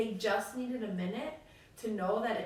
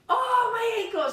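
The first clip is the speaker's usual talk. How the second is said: high, loud mock whine; whiny kid complaint; 11 semitones higher, 10 dB louder than usual. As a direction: play the hurt kid milking it. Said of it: "someone will be like".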